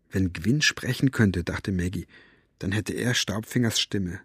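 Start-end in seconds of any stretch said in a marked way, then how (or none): none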